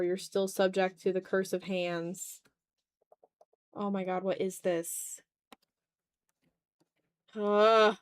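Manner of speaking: ordinary talk, nothing marked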